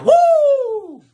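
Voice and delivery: very high, loud "woo"; siren-like falsetto whoop; high beatbox whoop. Acted. DJ Troll finishes his beatbox run, whooping high.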